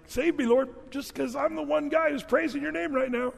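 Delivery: high-pitched mock begging; hammed-up high bargaining plea; playful acted plea. David's plea to God, paraphrased playfully.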